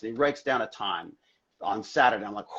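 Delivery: ordinary talk, nothing marked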